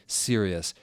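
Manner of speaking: ordinary delivery, nothing marked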